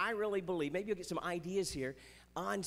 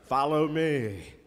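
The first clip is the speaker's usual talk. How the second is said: slow, deep, loud, stiff movie delivery; solemn old movie line, slow and heavy; stiff Jesus-movie Jesus; 3 semitones lower, 8 dB louder, much slower than usual. Retold or imitated. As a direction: intone it like a wooden film epic. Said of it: "are they a little stiff".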